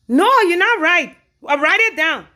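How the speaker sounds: loud, high-pitched objection; indignant retort. Retold or imitated. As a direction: push back hard, loud and high. Acted quarrel with husband.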